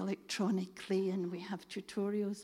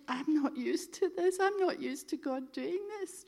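imitating an overwhelmed woman, pitched up; mock tearful amazement in a higher voice; tearfully overwhelmed; 6 semitones higher than usual. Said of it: "then she started crying and she said".

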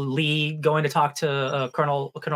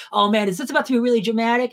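higher, eager voice, bracing for drama; mock dramatic suspense; 7 semitones higher than usual. Friend telling cohosts how she expected drama, mock eager voice. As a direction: play up the suspense of a twist coming.

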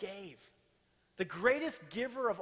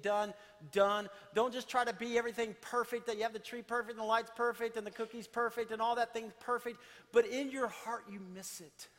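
fervent preaching; 4 semitones higher than usual. Acted. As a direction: drive the warning home with force. Energetic exhortation before quiet close.

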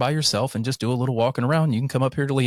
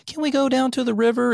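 high, pleading kid voice imitated; kid asking; 13 semitones higher than usual. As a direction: mimic a kid begging to go out. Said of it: "My kids are always like".

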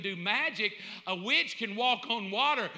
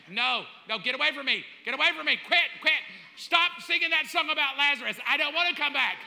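louder, higher, hammed-up refusal; mock frantic protest; 4 semitones higher, 4 dB louder, faster than usual. Dead man to praying mourners, mock frantic protest. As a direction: fend them off in mock panic.

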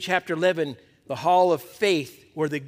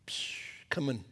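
whispered rain sound; 5 semitones lower, 11 dB quieter than usual. Acted. Describing the rain cloud arriving.

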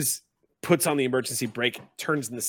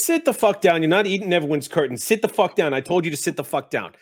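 loud, high-pitched exasperated order; angry scolding; 5 semitones higher, 8 dB louder than usual. Acted. Red cap tells the goblin to sit down, angry shouting. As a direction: shout it down like a fed-up driver at a back-seat pest.

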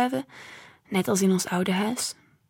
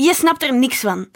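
raised, loud cut-in; angry retort; 5 semitones higher, 7 dB louder than usual. Acted. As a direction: interrupt with an angry, higher-pitched protest. Angry son cuts mother off. (boos en luid onderbrekend)

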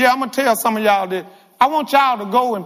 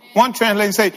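ordinary talk

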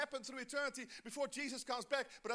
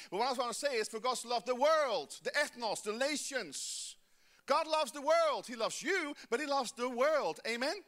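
loud, emphatic preaching; preaching at full force; 3 semitones higher, 7 dB louder, slower than usual. Acted. Pastor urging the church toward world vision, loud. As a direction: drive it home to the crowd.

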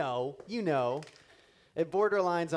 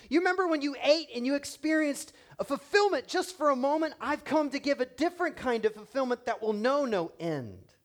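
full-force preaching; 7 semitones higher, 4 dB louder than usual. Acted. Preaching Jesus's invitation at full force.

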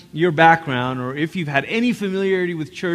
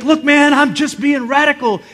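zealous new convert; 7 semitones higher, 4 dB louder than usual. Retold or imitated. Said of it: "you're like".

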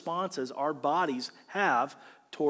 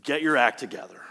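low, understated scolding; mock divine rebuke; 7 semitones lower than usual. God imagined rebuking the psalmist, low mock scolding. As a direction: deliver a dry imitation of a reprimand. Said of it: "You don't read verse five and God saying".

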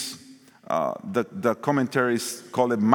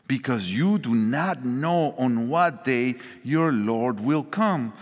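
ordinary delivery

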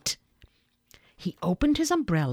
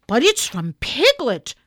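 louder, higher exclamation; cried out; 5 semitones higher, 6 dB louder than usual. A narrator's reading. Boy reads who sent the message, crying out. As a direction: cry it out on reading the news. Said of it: "cried Christopher Robin when he'd read it".

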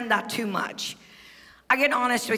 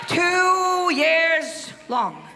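full-force preaching; 7 semitones higher than usual. Acted. Preacher lifting the congregation, shouting testimony.

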